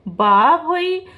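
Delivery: slow, drawn-out shocked "oh my"; exclaiming in disbelief at the news; stunned gasp. Real, not acted. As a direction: let the shock stretch out.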